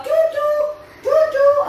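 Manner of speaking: high playful toot toot; tractor toot. Pretend. Tractor sound for kids.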